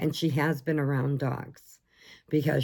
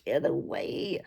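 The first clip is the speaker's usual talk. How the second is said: cat whispering; 4 semitones lower, 4 dB quieter, slower than usual. Pretend. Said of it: "and he's like".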